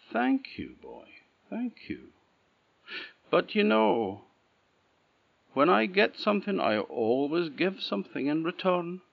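put-on high, thin old woman thanking the boy; old woman's voice.

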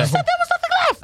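very high, fast indignant squeak; outraged falsetto. Acted. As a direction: squeal it in disbelief.

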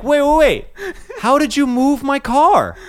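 retold bewildered questioning; baffled. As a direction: demand to know how. Confused caller questioning chief.

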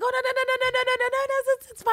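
very high, rapid pleading no's; panicked Julian. Retold or imitated. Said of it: "And he's like".

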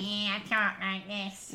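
mock Mancunian, through the nose; nasal Mancunian impression; nasal Manchester accent. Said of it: "I think it's really nasal".

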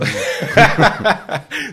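sudden high laugh; loud amused laugh; burst of laughter. Real, not acted. Laughs at the ch'ti joke.

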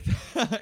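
quick high burst of laughter; a sudden laugh that breaks off; short laugh. Real, not acted. Laughs at own rambling.

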